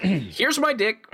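high, drawn-out mock reveal; brazen mock announcement. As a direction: announce it grandly, as a joke. Mimicking a brazen nude model.

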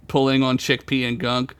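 usual speaking voice

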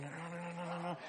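soft hushed pleading no; whispered no. Retold or imitated. Girlfriend calming the angry man, hushed whisper. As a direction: girlfriend hushing him, softly. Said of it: "she would whisper to him".

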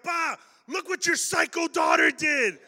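high, loud tattling yell to Pa; shout to dad. Retold or imitated. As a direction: yell up to dad, outraged and hurt. Running upstairs to dad, bleeding.

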